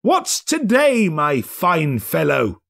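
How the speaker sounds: put-on old man hailing a boy; Scrooge calling out. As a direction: call down to the boy.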